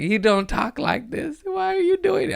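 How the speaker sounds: very high Alabama twang; Alabama accent. Retold or imitated. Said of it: "Alabama accent, and I was like".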